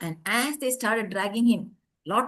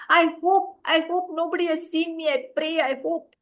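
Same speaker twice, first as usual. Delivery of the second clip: higher, anxious pleading to himself; fearful hoping; 5 semitones higher than usual. A narrator's reading. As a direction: mutter a frightened hope while fleeing. Driver fleeing, fearful prayer.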